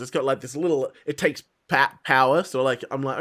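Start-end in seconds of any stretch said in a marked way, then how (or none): none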